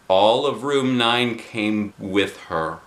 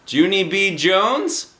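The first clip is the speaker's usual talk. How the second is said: higher questioning call, rising; teacher calling; 10 semitones higher, 4 dB louder, slower than usual. Acted. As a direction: call her name across the room. Teacher calls from the front.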